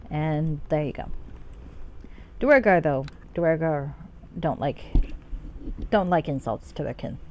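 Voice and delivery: usual speaking voice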